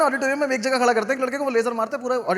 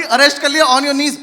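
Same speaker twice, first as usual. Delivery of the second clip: louder, barked pretend arrest order; mock cop command; 3 semitones higher, 9 dB louder, slower than usual. Comic playing a forgetful cop arresting someone, mock command. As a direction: play a bossy cop for laughs.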